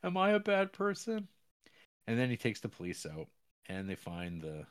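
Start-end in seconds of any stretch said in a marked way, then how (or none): 0.0-1.3 high, loud mock-weepy confession; mock crying. Character confesses to burying a body, mock crying. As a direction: imitate a tearful man wailing in a raised pitch. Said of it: "crying 'cause he's like".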